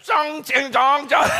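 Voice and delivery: loud, high mock-Chinese nonsense syllables; hammed-up gibberish posing as Chinese; dumbest fake Chinese. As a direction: blurt out silly fake Chinese, nearly laughing. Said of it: "starts making the dumbest sounds".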